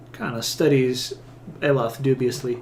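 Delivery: normal talking voice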